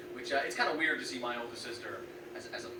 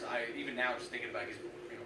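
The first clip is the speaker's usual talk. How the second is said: normal talking voice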